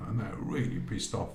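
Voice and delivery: ordinary delivery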